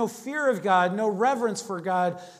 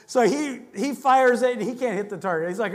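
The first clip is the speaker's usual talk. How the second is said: normal talking voice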